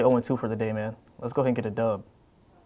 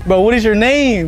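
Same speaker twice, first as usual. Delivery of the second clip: loud; 5 semitones higher, 11 dB louder, slower than usual. Acted. Pretends not to hear, shouting.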